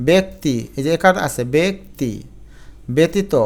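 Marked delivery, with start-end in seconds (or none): 0.1-0.5 ব্যক্তি as "bek-ti"; "e" vowel. Shown as a demonstration. Teacher showing j-fola as e, ব্যক্তি as "bek-ti". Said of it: "জ ফলার উচ্চারণ এ হয়". 1.6-3.3 ব্যক্তি, ব্যতীত as "bek-ti, be-ti-to"; "e" vowel. Shown as a demonstration. Teacher showing e-kar sound, ব্যতীত as "be-ti-to". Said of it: "একারের মতো হয়ে গেল".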